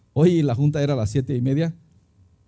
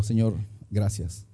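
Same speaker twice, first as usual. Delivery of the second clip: low, soft, slow thanks; grateful prayer; 7 semitones lower, 7 dB quieter, slower than usual. Retold or imitated. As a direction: murmur thanks to God, acting out the moment. (agradecido, bajito y despacio)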